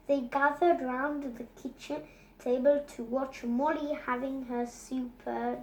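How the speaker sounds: ordinary delivery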